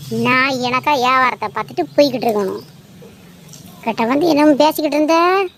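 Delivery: ordinary talk, nothing marked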